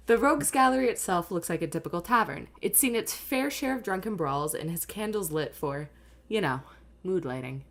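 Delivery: lightly voiced scene setting; game master narration. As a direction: read it like a dungeon master.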